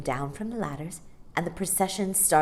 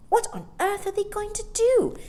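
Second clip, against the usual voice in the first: put-on much higher, wide-eyed child's voice; amazed little boy; 5 dB louder than usual. A narrator's reading. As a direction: ask it like an astonished young boy. Michael wondering aloud.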